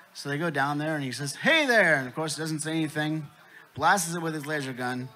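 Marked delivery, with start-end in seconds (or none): 1.4-2.0 high, loud, falling hail; calling out greeting. Acted. Alien dad hails silent human again, calling out. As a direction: holler hello to a stranger.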